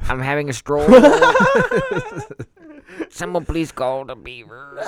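a goofy Quebec voice through giggles; French Canadian accent. Pretend. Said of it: "I'm actually French Canadian".

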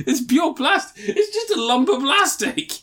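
high, loud exasperated rant; excited. Real, not acted. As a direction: pitch it way up in disbelief. Excited rant about plastic figure.

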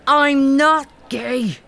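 flustered, emphatic protest; indignant denial. Acted. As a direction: set the record straight, hard.

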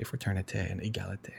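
Fraternité and égalité whispered softly in French, almost no voice. Shown as a demonstration. Reading the French motto aloud, a soft whispered aside. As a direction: breathe Fraternité and égalité under your breath in French.

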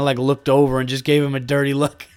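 ordinary delivery

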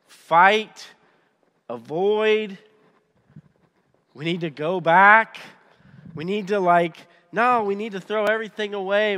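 loud, urgent mock slogans; clamouring voices. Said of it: "we have all these voices going on".

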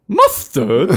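put-on sudden British-accented outburst; busting out an accent. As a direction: burst out with a mock British query. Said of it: "you might just bust out and go".

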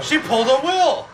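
high, loud gleeful exclamation; excited. Real, not acted. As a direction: blurt it out delighted. Excited remark on the movie.

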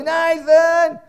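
put-on high, loud call for a lost child. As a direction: call across the shop for your kid. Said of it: "You just start calling".

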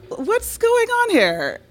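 very high, drawn-out puzzled question; high-pitched comic bafflement; bewildered squeal. Retold or imitated. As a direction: squeak it out slowly, thrown by what you see. Puzzled reaction to friends' intimacy.

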